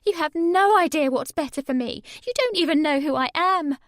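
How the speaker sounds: high, loud shouting rant; furious rant. A narrator's reading. Sammy raging at her mum on the phone. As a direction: hurl every line at her. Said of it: "Sammy shouted".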